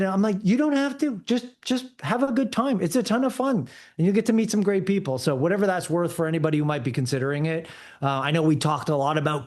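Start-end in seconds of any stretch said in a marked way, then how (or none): 0.4-5.2 upbeat coaxing. Retold or imitated. Urging another dad to race.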